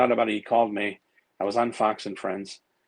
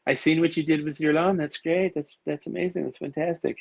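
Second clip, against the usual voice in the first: higher, gushing presidential praise, lightly voiced; imitating Trump; 7 semitones higher than usual. President praising his painted lawn, a light higher impression. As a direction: pile on the praise like a showman. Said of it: "It's like".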